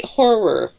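"horror" as a model, hor-ror; two-syllable horror. Shown as a demonstration. Asking co-host to repeat it, careful two-syllable horror. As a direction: give "horror" a clear second syllable. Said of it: "that second syllable is very important".

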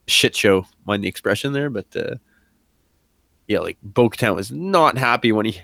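usual speaking voice

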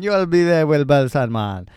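loud Jamaican roadman sign-off. Acted. Host finishing the sign-off as a loud Jamaican roadman. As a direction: keep the Jamaican roadman going. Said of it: "Jamaican roadman".

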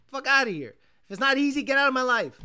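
loud fed-up outburst; frustrated outburst. Real, not acted. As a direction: shove it away loudly. Frustrated rant at website.

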